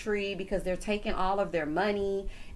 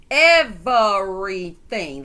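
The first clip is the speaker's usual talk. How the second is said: Everything stretched slow and loud; drawn-out exasperation; 9 dB louder, much slower than usual. Real, not acted. Host fed up with the complaining cast, slow loud emphasis. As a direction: lean hard and slow on Everything.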